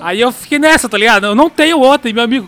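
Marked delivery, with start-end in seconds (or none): 1.3-2.1 firm refusal. Retold or imitated. Refusing driver, tense retold.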